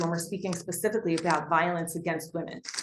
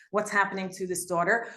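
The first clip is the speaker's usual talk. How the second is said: normal talking voice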